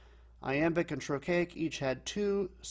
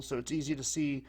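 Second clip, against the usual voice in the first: ordinary talk, nothing marked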